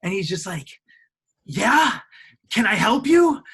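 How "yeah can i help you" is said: higher, louder snapping challenge; a fed-up clerk confronting guests; loud, annoyed "can I help you"; irritated clerk. Retold or imitated. Sweaty clerk storms out, confronting.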